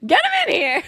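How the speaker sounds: mock [name] voice. Friend voicing [name] approving Travis's pick, playful high voice. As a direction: squeak it out like an eager star. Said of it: "And she was like".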